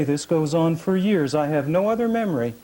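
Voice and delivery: ordinary delivery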